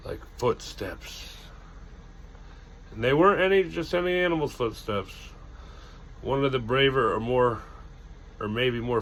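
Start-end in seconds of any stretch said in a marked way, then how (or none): none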